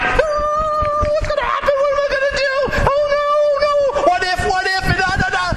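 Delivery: high, hammed-up frantic worry; mock the panic we feel inside; squeaky half-laughing fake fret; inner panic. Acts inner panic of listeners.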